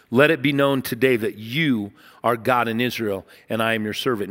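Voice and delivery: low, soft, reverent prayer; solemn prayer. Acted. Prophet prays softly before the crowd, reverent and low. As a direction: hush and call on God. Preacher voices Elijah's prayer.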